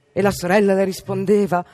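usual speaking voice

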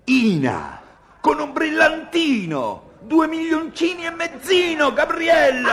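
very high, loud mocking of the diminutives; sarcastic outrage. Husband throws wife's diminutive back at her, outraged and mocking. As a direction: shriek each tiny word in furious mockery.

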